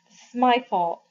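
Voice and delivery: put-on a boy blaming himself, miserable. A narrator's reading. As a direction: sound wretched and to blame. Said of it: "Johnny said in a miserable voice".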